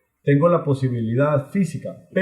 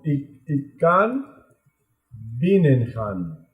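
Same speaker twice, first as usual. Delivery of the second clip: slow model phrase; much slower than usual. Shown as a demonstration. Teacher models Dutch.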